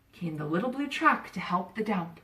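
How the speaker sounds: ordinary delivery, nothing marked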